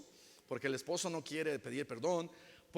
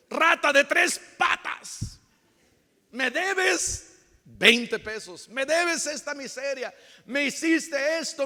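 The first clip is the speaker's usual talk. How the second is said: hammed-up shrill scolding, much higher and louder; mock angry accusation; 8 semitones higher, 7 dB louder than usual. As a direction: accuse like a petty grudge-holder. Mock grudge-holder scolding a debtor. (reproche exagerado y chillón)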